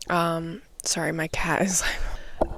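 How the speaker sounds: unvoiced whisper off to the side; whispered aside. Real, not acted. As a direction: murmur an apology under your breath. Aside about her cat.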